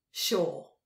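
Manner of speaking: a loud snarled "Sure" that falls. A narrator's reading. Crow snarls disbelief at Tal's innocence. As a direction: spit out "Sure" with contempt. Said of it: "snarled Crow".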